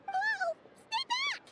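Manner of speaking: very high, squeaky panic; alarmed. Acted. Paimon tells the eager stranger to stay back, panicked squeal. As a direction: squeal a warning to keep away. Paimon fends off the advancing Linnea.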